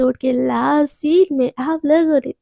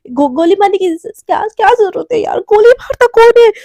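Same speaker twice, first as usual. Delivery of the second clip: higher and louder, rising into a frightened yell; panicked outcry; 5 semitones higher, 5 dB louder than usual. Acted. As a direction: panic as bullets fly at her feet. Mishka panics at the shooting.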